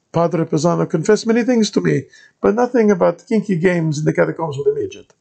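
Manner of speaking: put-on Italian-accented padre greeting a stranger; thick Italian accent. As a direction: play the monk in a heavy Italian accent. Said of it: "said Gilberto".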